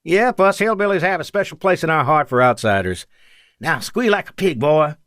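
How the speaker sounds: high-pitched, teasing hillbilly voice; thickened hillbilly accent. A narrator's reading. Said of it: "Victor thickened his soft hillbilly accent".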